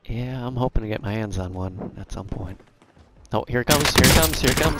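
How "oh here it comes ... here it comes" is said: rising, louder excitement; building excited anticipation. Real, not acted. Enemies closing in, tense moment.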